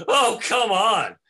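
loud, high, drawn-out protest; hammed-up cry of exasperation; exasperated outburst. Brock protesting as ninjas drop from sky, exasperated. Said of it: "At which point Brock says".

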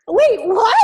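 loud, high mock-stunned reaction; shocked disbelief. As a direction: imitate a floored mom. Mom shocked by pregnancy news.

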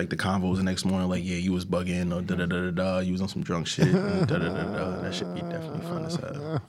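usual speaking voice

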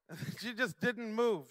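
ordinary talk, nothing marked

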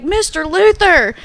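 high, slow called name; young fan hailing the star; calling out. Retold or imitated. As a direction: fan calls across the hall.

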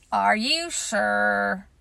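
put-on slow, glum old donkey voice; gloomy donkey. A narrator's reading. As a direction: sigh it out like a sad donkey. Eeyore doubting.

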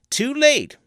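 a conjurer standing to force the pact; insistent conjurer. A narrator's reading. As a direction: cry it out, rising to his feet. Teague rises, insisting.

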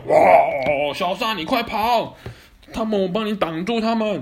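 high, loud shout to run; urgent shout. Acted. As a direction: yell a warning. Friends shout to flee.

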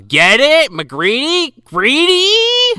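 GREEDY pushed up high, questioning; mock pun-nudging. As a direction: hammer home GREEDY with an exaggerated rising lilt. Hammering home the pun on greedy.

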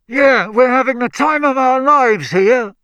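high, loud, squashed-sounding voice; muffled sarcasm. A narrator's reading. Squashed brother joins in, muffled and sarcastic. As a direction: speak as if squashed against a wall. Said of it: "whose voice sounded muffled".

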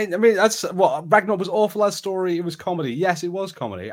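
normal talking voice

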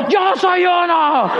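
very slow, high-pitched fervent cry; Marta. Retold or imitated. Companion swept up in the crowd's slogan, mock fervent cry. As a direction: draw it out like a fresh convert. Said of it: "Marta estaba diciendo".

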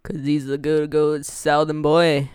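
slow, badly faked Southern drawl; bad Southern accent. Host jokes about Jasper as a good Southern boy, bad Southern accent. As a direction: put on a poor Southern US accent. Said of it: "that was a bad accent".

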